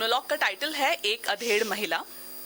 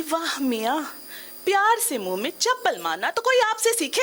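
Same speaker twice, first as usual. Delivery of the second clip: sarcastic praise, rising higher on the punchline; smiling retort; 9 semitones higher, 6 dB louder than usual. Acted. Woman turns to her friend and answers back, smiling sarcasm. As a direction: smile through a barbed compliment. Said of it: "मुस्कुराते हुए बोले".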